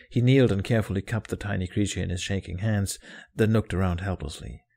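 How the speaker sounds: ordinary delivery, nothing marked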